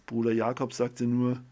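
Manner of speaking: plain reading voice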